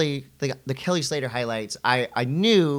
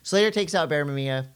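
ordinary talk

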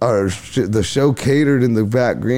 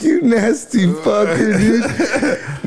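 high, slow, laughing through the insult; cracking up while calling him gross; slow laughing mock insult; laughing disgust; 10 semitones higher, much slower than usual. Laughing at Chong's confession.

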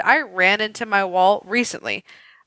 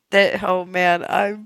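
ordinary delivery

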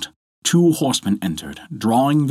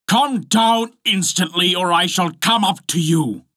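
bawling; 9 semitones higher than usual. A narrator's reading. Husband ordering his wife down, bawling. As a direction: call out a threat in a raised pitch. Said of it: "cried out as loud as he could bawl".

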